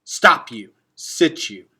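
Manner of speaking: loud commanding halt, short and sharp; loud clipped order to halt; barked order. A narrator's reading. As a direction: shout the command to stop the column. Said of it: "gave the order to halt".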